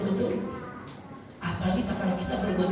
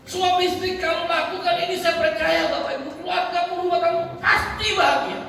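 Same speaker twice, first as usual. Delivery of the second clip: higher-pitched, impassioned sermon delivery; fervent preaching; 9 semitones higher, 3 dB louder than usual. Acted. Preacher urging couples to serve with love, fervent. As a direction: preach it with rising urgency.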